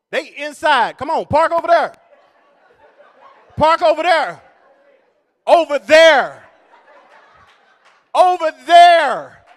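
loud, high yelling over there; shouting directions. Retold or imitated. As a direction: wave cars in, yelling. Parking attendants shouting in rain.

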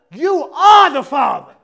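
host announcing the result, slow and loud; mock announcement. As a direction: boom it like a talk show host reveal. Announcing paternity result like Maury.

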